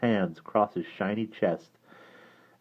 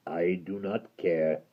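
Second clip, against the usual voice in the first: deep gravelly doom; menacing creature pronouncing fate; low, slow creature verdict; 5 semitones lower, 6 dB quieter, slower than usual. Acted. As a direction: sentence them slowly and low. Creature dismisses.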